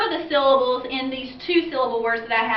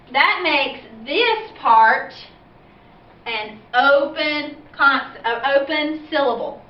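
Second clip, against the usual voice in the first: slow emphasis; slower than usual. Shown as a demonstration. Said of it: "teacher slows to stress rule".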